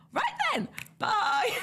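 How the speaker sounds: mock-cheery squeaky exit; over-bright goodbye. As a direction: pipe up high and flee. Nervous exit from interview, retold.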